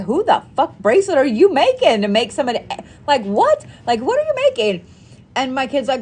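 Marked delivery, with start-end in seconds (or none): 0.0-4.8 high, fast, baffled outburst; re-enacting her shock at her kid; retold disbelief. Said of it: "I was like".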